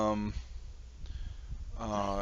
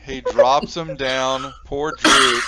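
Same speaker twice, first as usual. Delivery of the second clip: excited; 5 semitones higher, 10 dB louder, slower than usual. Real, not acted. DM narrates the fall, amused.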